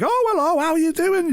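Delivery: chirpy line manager. Retold or imitated. Manager greeting a tipsy employee, high sing-song. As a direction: greet them sweetly and brightly.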